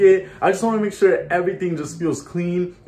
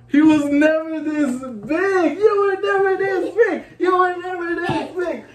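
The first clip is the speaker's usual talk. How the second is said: very high, drawn-out squeal; playful amazement; 12 semitones higher, slower than usual. Real, not acted. Playfully exclaiming at son's growth.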